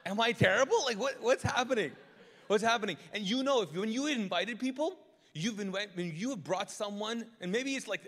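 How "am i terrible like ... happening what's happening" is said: loud, high, laughing disbelief; comic panic with a laugh in it; laughing mock panic. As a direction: play the alarmed host, laughing through it.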